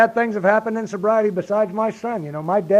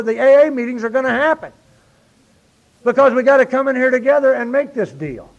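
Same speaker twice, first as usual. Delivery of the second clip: raised, high, forceful testimony; impassioned preaching; 7 semitones higher, 7 dB louder than usual. Real, not acted. Speaker rallying fellow AA members around the meetings, impassioned preaching. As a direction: preach it loud and high to the room.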